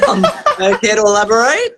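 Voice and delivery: high-pitched and giggling through the words; laughing. Real, not acted. Guest fishing for compliments from host, laughing high.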